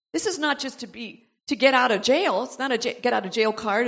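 usual speaking voice

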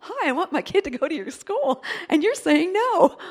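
mock pleading little mom. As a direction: play the small helpless mom. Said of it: "I'm just this little mom going".

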